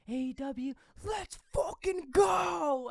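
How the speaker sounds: rally the crowd from the ring; wrestler's shout. Retold or imitated. The wrestler firing up the crowd, shouting. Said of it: "and then just saying".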